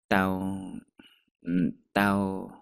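halting, with long gaps; reluctant, broken-off starts; hesitant. Acted. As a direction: stall before a confession. Hesitant to confess to friend.

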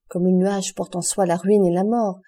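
ordinary delivery

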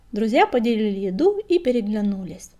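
usual speaking voice

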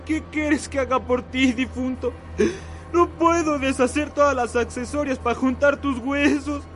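sobbing plea to a ghost; crying. Acted. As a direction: weep through the words.

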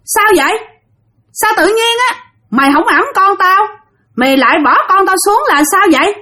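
high-pitched demanding ghost woman; ghost mother confronting. Retold or imitated. Ghost mother confronts the man who dropped her child, high and accusing. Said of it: "cái tự nhiên anh nghe tiếng nói".

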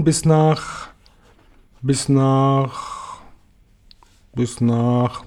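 drawing out the last word, long pauses; hesitant searching. A narrator's reading. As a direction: trail off as if searching for the destination. Searches for a destination, trails off. (zögernd, suchend gedehnt)

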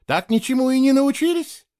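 high, exasperated lecturing question; preachy reproach. A narrator's reading. Girl lecturing Gerik after the fall, preachy. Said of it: "нравоучительно сказала она Герику".

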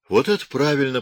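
high, brightening voice; cheered up. A narrator's reading. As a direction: perk up, relieved. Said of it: "повеселел полицай".

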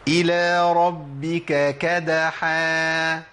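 كدحا recited very slowly with a light kaf, not a qaf; slow recitation contrasting kaf with qaf; slow Quran recitation. Shown as a demonstration. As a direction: draw out إلى ربك كدحا and mark the kaf in كدحا. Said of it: "بالكاف".

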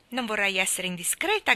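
ordinary delivery

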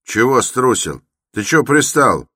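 high, loud comeback; angry retort. A narrator's reading. Porter answers Raskolnikov's taunt, angry and loud. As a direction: fire back at a taunt.

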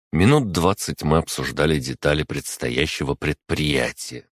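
usual speaking voice

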